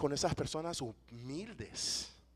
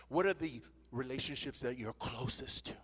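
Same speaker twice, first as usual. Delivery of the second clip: whisper; 2 semitones lower, 6 dB quieter than usual. Real, not acted. Pointed question to congregation.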